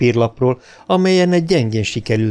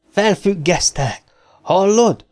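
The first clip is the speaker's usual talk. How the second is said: bellowing; 9 semitones higher than usual. Acted. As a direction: yell the suspension at him. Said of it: "üvöltötte".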